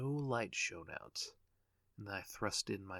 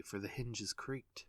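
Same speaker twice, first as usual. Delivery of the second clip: plain reading voice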